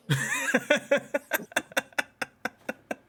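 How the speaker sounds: high-pitched, drawn-out laughter; real extended high laugh; long laugh. Real, not acted. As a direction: burst out in a long chuckle. Host laughs at the flat answer.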